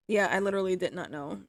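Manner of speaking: ordinary talk, nothing marked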